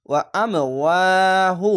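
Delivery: "ওয়া আম ওয়াহু", push on আম, long second ওয়া; jolting emphasis. Shown as a demonstration. Teacher recites the word for learners, jolted then stretched. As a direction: hit আম, then draw out the ওয়া slowly. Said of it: "ঝোঁক মেরে পড়তে হবে".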